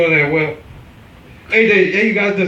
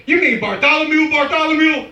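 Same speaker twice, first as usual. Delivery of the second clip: surprised; 7 semitones higher than usual. Retold or imitated. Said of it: "You never hear".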